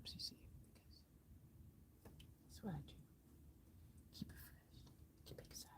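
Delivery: breathy whisper. Real, not acted. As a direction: whisper it to yourself. Whispered aside while parked.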